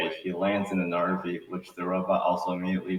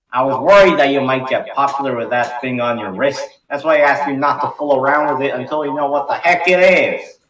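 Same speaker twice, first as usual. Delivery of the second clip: put-on loud, high scolding old grandfather; scolding grandpa; 7 semitones higher, 11 dB louder than usual. Grandpa scolding Ben for fooling with the watch, loud and stern. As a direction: lecture the boy sternly as grandpa.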